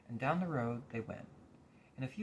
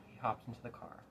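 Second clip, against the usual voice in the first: ordinary delivery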